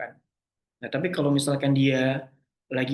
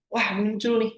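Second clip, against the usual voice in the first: higher-pitched sudden realisation; playfully startled exclamation; mock surprise; 7 semitones higher than usual. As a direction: act out a thought popping up. Acting out a thought suddenly appearing.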